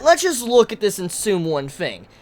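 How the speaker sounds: ordinary talk, nothing marked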